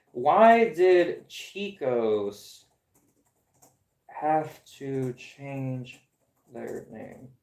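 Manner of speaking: very slow, sinking and fading to near nothing; slow drawn-out lament. Real, not acted. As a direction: drag each word out, dying away.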